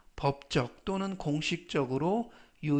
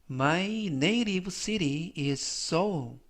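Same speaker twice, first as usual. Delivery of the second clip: very slow, word-by-word example sentence; slow model reading; 2 semitones higher, much slower than usual. Shown as a demonstration. Teacher reading the example sentence for learners to repeat, very slowly. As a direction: read it out very slowly for learners to follow. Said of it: "제가 역시 천천히 세 번씩 읽어드리고요".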